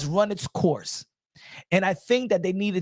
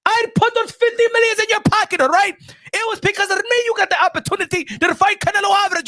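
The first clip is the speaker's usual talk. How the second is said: high, loud hammed-up yelling; mock furious scolding; 11 semitones higher, 4 dB louder than usual. Sanctioning boss yelling at Crawford, high and loud. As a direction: chew him out like a furious boss.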